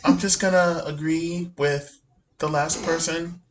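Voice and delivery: slow, soft imitation; mocking a quiet talker. Mocking Sarah agreeing with the last person, slow and quiet. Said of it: "That's how you talk right now".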